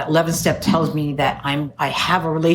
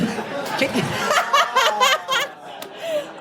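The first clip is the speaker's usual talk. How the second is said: high burst of real laughter. Real, not acted. Laughing at her own city ignorance. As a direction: let a high laugh break out. Audience corrects her word.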